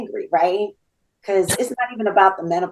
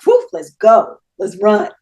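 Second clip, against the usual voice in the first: loud, eager hurry-up call; pumped, loud let's-go rally; urgent excitement; 9 dB louder than usual. Retold or imitated. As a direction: fire yourself up to go. Rushing off to the hospital.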